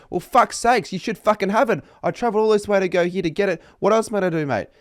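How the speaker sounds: loud, high hypothetical outburst at staff; mock angry scene. Customer yelling at a shop worker who lacks the cord, hammed-up fury. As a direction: ham up a furious customer tantrum. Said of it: "cause a massive scene saying".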